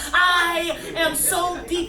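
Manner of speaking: hammed-up poetry shout; shouted refrain. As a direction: bellow it like a slam-poem climax. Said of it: "escalating shouted refrain".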